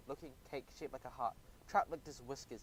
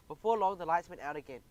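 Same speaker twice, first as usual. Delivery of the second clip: usual speaking voice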